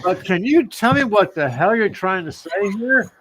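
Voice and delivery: high-pitched, pleading mock exasperation; exasperated apologetic plea. Man begging a Southern client to explain, exasperated. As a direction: plead sweetly, then burst out baffled.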